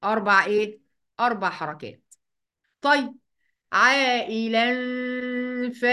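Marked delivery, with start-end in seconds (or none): 3.7-5.8 "عائلا" slow, long aa and hummed ending; madd and ikhfa. Shown as a demonstration. Teacher isolating the rule, "عائلا" drawn out with ghunna. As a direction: lengthen "عائلا" and hide its nun in a hum. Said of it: "هنعمل إخفاء حقيقي بغنة".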